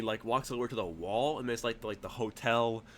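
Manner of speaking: ordinary talk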